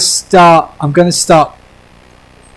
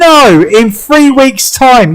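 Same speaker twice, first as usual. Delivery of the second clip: drawn-out, high sarcastic retort; 8 semitones higher, much slower than usual. Real, not acted. Answering a friend who asks about timing, heavily sarcastic. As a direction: sarcasm laid on slowly, pitch swooping down. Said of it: "I was being sarcastic".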